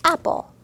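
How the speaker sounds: bright blended Apple; blended demo. Shown as a demonstration. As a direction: sweep it together in one go. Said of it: "So we can go like this".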